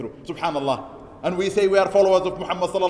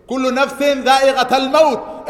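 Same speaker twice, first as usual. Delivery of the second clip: loud recitation; 6 semitones higher, 7 dB louder, faster than usual. Real, not acted. Imam reciting the verse to worshippers, loud chanted recitation. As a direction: ring out the verse like a call. Quoting Quran to congregation.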